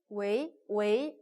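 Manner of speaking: 웨이 slow and deliberate; model syllable. Shown as a demonstration. Teacher drills 웨이 for the class. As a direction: say 웨이 slowly and clearly. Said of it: "이렇게 가시면 되겠어요".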